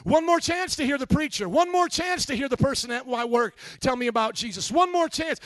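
voicing the damned pleading from hell; pleading cry. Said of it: "screaming out".

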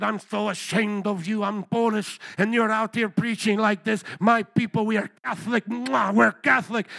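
indignant imitation of a Polish man; Polish accent. Polish man scolding the preacher, imitated Polish accent. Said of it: "Another Polish guy".

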